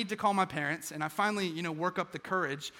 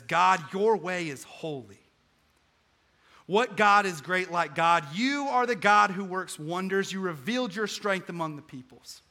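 fervent preaching; 3 semitones higher, 7 dB louder than usual. Acted. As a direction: build it up like a preacher. Preacher quoting the psalm.

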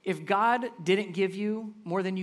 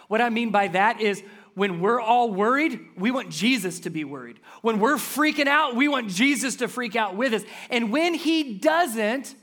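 higher and louder, driving sermon voice; full-force preaching; 5 semitones higher, 7 dB louder than usual. Acted. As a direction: preach it out to the whole room.

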